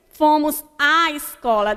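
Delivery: "à" stretched like two A's in "fomos à escola"; doubled A. Shown as a demonstration. Teacher illustrating crase to pupils, lengthened "à". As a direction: lean on a long "à" before "escola". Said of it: "dá a entender que eu estou falando dois A".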